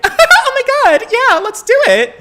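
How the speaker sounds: very high, loud, gushing preppy squeal; hammed-up peppy girl voice; preppy Valley Girl. Shown as a demonstration. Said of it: "Would she be more preppy?".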